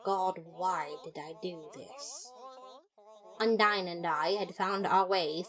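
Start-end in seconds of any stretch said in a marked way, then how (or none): none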